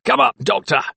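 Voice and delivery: barked order. A narrator's reading. Keeper to doctor on the stairs, barking. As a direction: bark it as a command. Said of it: "barked Wisdom".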